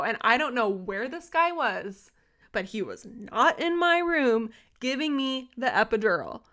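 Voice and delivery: ordinary talk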